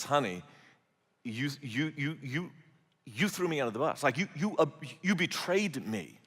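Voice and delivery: soft, stammering, wounded husband; hurt accusation. Acted. Samson to his bride after she gave away the riddle, soft stammering hurt. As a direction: stumble over the words, stunned and betrayed.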